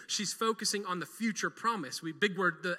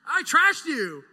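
louder, slower, higher; emphatic confession; 7 semitones higher, 11 dB louder, slower than usual. Real, not acted. Preacher confessing to Martha, loud and slow. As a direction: hammer home the guilt.

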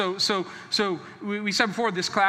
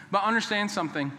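usual speaking voice

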